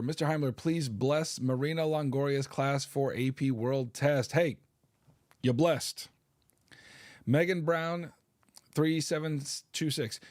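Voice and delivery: usual speaking voice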